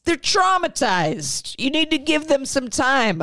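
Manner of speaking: high, mocking pleading dad. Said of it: "And the dad's like".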